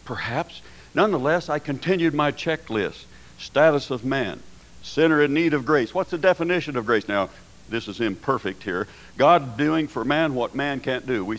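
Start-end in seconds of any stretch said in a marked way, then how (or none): none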